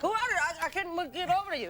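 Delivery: high, flustered imitation of a busboy; oblivious busboy. Busboy trying to serve mid-proposal, flustered calling out. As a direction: call out as you struggle to reach the table.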